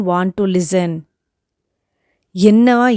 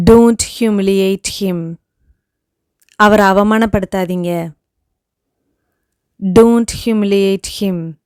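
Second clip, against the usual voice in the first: ordinary talk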